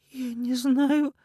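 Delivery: high, very slow, lost; drawn out. A narrator's reading. Woman asked what to do with the body, drawn out. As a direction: stretch the words helplessly. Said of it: "протянула Ирина".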